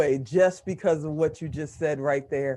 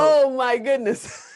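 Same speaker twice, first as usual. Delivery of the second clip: high, slow, laughing astonishment; real amazement; 6 semitones higher, 4 dB louder, slower than usual. Real, not acted. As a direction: marvel aloud, drawing it out with a laugh. Listener astonished by son's album.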